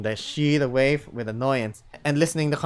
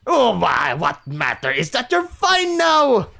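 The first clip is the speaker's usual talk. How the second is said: loud, high, slow attempt at Scottish; booming stab at a Scottish accent; 7 semitones higher, 8 dB louder, slower than usual. Old wolf sniffing the room, attempted Scottish accent. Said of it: "give him a Scottish accent. I'll try".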